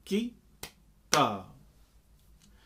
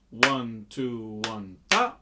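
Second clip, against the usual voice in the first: counted beats with ta punched louder; ta on the upbeat; 4 semitones lower, 5 dB louder, slower than usual. Shown as a demonstration. Teacher placing ta after the second main beat. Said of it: "the syllable ta, will fall".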